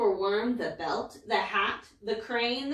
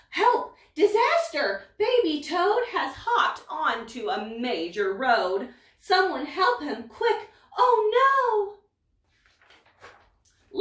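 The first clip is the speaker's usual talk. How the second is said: alarmed cry for help; 6 semitones higher, 5 dB louder than usual. Acted. Baby toad on a major road.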